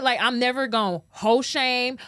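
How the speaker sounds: normal talking voice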